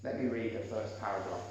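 usual speaking voice

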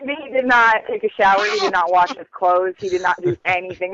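ordinary delivery

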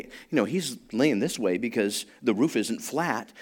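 ordinary talk

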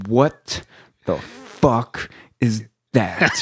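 slow, spaced-out disbelief; not yelling, imitated. Instructor pointing at long dyed hair, slow unyelled disbelief. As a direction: draw out each word without shouting. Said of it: "he's not even yelling".

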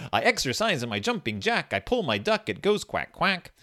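high, sing-song storybook read; reading to a toddler. Acted. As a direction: read it like to a baby. Reads baby book aloud.

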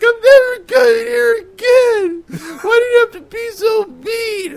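put-on a drunk birthday girl wailing at the comic; crying drunk woman. Drunk birthday woman sobbing at the comic, shrieking high. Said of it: "And she's just crying".

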